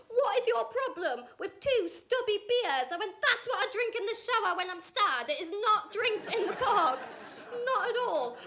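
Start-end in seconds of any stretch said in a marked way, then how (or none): 0.1-2.9 imitating his high, slow aggressive demand; mock aggressive man. Angry date demanding why she mocks his beers, a mock shout. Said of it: "he started to get a bit aggressive". 3.2-8.4 mock shouting back. Arguing with her date over beers, mock shouting. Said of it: "So I'm shouting back".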